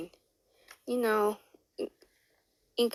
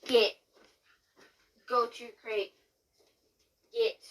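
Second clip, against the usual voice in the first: stern command to dog; 4 semitones higher than usual. Real, not acted. Ordering dogs into crate.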